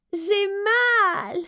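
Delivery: high, sharp cry of pain; a young princess crying out in pain. A narrator's reading. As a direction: cry out from a throbbing tooth. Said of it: "s'écria Élisabeth".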